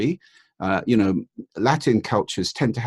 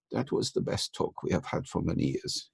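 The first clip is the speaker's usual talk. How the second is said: whispered, understated compliment; hushed Finnish praise; 9 dB quieter than usual. Retold or imitated. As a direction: say it almost in a whisper, restrained. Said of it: "several people came up and said".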